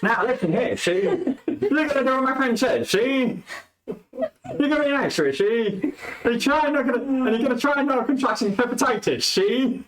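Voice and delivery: high-pitched noir gangster parody; American mafia. Acted. Bard taunts the skeleton with cutting words, American mafia. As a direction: ham up a mobster, cracking up slightly. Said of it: "American mafia".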